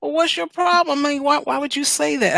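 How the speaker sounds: high, pressing challenge; heated challenge. Retold or imitated. Pressing someone who teased her, high and heated. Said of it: "I'm like".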